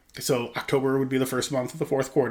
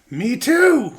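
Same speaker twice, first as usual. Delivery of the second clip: excited; 10 semitones higher, 7 dB louder than usual. Retold or imitated. Excited reply in retold chat.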